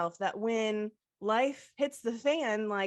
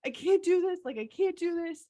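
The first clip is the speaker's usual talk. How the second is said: higher and louder, imitating sobbing panic; retold sobbing; 8 semitones higher, 3 dB louder than usual. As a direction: replay the gut-wrenching sob, half-acted. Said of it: "I started sobbing".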